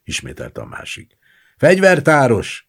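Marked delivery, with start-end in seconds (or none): 1.6-2.7 high, loud call; shouted summons. A narrator's reading. Gendarmes summoning the armourer, shouting. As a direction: shout for someone to come. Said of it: "kiáltották".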